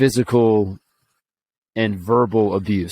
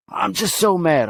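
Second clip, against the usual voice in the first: put-on higher little boy whine; imitating an upset kid; 8 semitones higher than usual. Nephew telling his uncle, higher child's voice. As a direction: play the upset six-year-old. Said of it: "tell me what's wrong".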